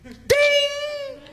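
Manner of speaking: a very high, ringing "Ding!"; high ding bell. Retold or imitated. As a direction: ring out the point like a bell. Imitating a point bell.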